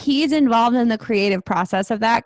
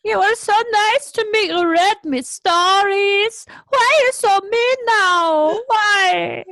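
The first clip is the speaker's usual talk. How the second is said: high, whiny fake sob, dragged out; hammed-up weepy begging; crying out to Sister Abigail; mock crying plea; 9 semitones higher, slower than usual. Acting out a Bray Wyatt crying scene.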